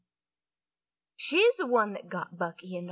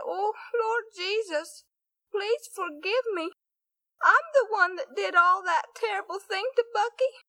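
put-on a guilty girl crying her confession; sobbing prayer; 10 semitones higher, 6 dB louder than usual. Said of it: "began to cry and sob".

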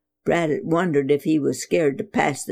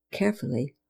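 much softer, almost held breath; hushed; 4 semitones lower, 8 dB quieter, faster than usual. A narrator's reading. Narrator easing the idol's head back, hushed. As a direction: tiptoe the word Carefully softly. Narrator slows for tense moment.

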